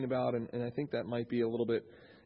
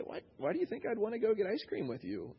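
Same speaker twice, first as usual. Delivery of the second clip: higher, softly teasing question; playful; 8 semitones higher, 4 dB quieter than usual. Acted. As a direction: tease her with mock reluctance. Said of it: "just kind of being playful".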